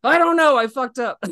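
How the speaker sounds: high, loud exasperated confession; a mock meltdown, half laughing; hammed-up panic. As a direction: throw up your hands and blurt it out. Admitting he ruined the match.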